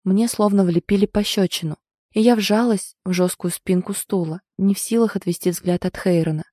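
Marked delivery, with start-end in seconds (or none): none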